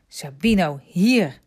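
loud call, firm hier; boy calling his dog back, loud. A narrator's reading. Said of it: "riep Remy".

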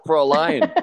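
loud, clipped Fraulein barked like a film officer; mock German; movie German. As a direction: bark Fraulein like a movie Nazi. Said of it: "That's all I know".

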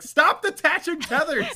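high, loud pleading yell; exasperated shout. Retold or imitated. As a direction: shout it at the screen. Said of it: "I was like".

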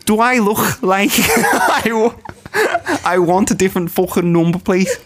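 lightly done Scouse demand, swearing; Scouse accent. Acted. Asking a friend for the number plate, mock Scouse accent. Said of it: "I'm in character for Scouse".

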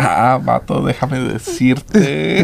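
slow, laughing put-on Mexican accent; mock Mexican accent. Telling a friend Mexico's drinking age, slow mock Mexican accent. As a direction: lean in like a know-it-all Mexican buddy, in a Mexican accent.

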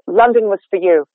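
"for" as a light fuh, schwa, unstressed; reduced for. Shown as a demonstration. Coach demonstrating the better casual reading. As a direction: throw "for" away as fuh. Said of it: "is much better than".

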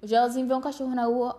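ordinary delivery

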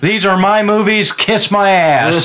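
a proud star telling fans off, high and drawn out; an actor defending his films against a fan, high and slow; indignant actor. Retold or imitated. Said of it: "and he's like".

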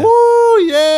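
high, loud whooping cheer; crowd roar. Retold or imitated. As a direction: whoop like a roaring street crowd. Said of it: "everybody just roars like".

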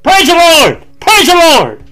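"Praise the Lord!" shouted high and loud. Shown as a demonstration. Preacher showing the church how to praise, shouting loudly. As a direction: shout praise with full joy. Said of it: "When's the last time you heard somebody".

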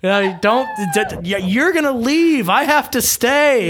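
exasperated scolding. Retold or imitated. Telling dad off at reward.

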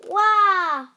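high, loud "wah" of a crying princess; loud mock wail. A narrator's reading. Princess wails over the disobedient dragon, loud mock wail. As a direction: bawl one long cartoon wail. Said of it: "the princess wailed".

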